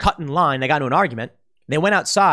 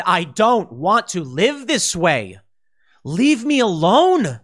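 weary plea; 3 semitones higher, 3 dB louder, much slower than usual. Retold or imitated. Fed-up public telling scolds to leave them alone, slow and weary. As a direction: stretch it out, worn down. People who snapped, voiced.

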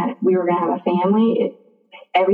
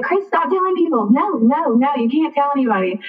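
retold urgent protest; 6 semitones higher than usual. Urgently trying to stop him.

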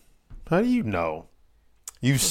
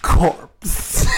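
failed seductive; 3 semitones higher, 3 dB louder than usual. Shown as a demonstration. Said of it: "I can't even do it".

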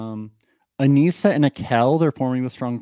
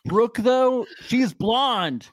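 frat bro; 10 semitones higher, much slower than usual. Retold or imitated. Mocking Leo as bro.

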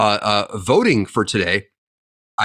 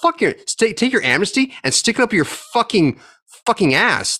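higher, rising into an outraged yell; furious rant; 9 semitones higher than usual. Real, not acted. Ranting podcaster telling politicians where to stick amnesty, outraged. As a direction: spit it out at the politicians.

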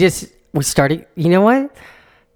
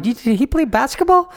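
mock clueless question; 10 semitones higher than usual. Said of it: "I'm like".

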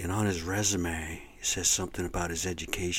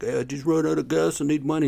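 higher, louder plea for gas money; imitating a stranger; 7 semitones higher, 6 dB louder, faster than usual. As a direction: beg like the roadside guy. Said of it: "He's like".

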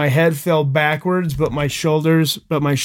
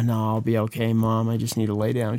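softer and lower, brushing it off; playing it down; 4 semitones lower, 6 dB quieter than usual. Injured son brushing off his mom's hospital order, quietly downplaying. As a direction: act like it's nothing, tired and low.

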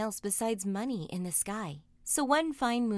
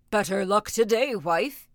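loud, house-shaking ogre voice; big ogre hailing his wife; booming ogre; 7 dB louder than usual. A narrator's reading. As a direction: boom it out like a storybook giant. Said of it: "his voice shook the house".

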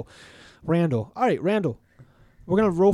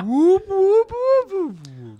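whooping; 8 dB louder, much slower than usual. Pretend. Rubbing the magic lamp.

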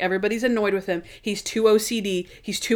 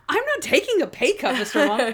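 high, indignant scolding voice, half-joking; mock indignant Natalie; 6 semitones higher than usual. Assistant scolding her boss in a high, mock-indignant voice. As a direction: scold him in a raised, put-upon voice.

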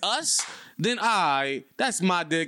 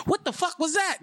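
high mock quote; 12 semitones higher than usual. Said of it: "if you ever said".

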